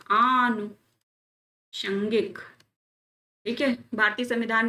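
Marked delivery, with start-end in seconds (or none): 0.1-2.4 आनुषंगिक very slowly, "aanu... shangik"; correct form, dictated. Shown as a demonstration. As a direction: break आनुषंगिक in two with a long aa. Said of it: "ऐसे लिखते हैं".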